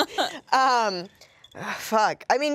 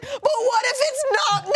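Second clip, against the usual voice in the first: much higher and slow, half laughing, half wailing; laughing self-doubt; 13 semitones higher, slower than usual. Retold or imitated. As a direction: squeak it through the giggles. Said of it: "and I was like".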